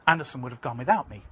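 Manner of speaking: ordinary delivery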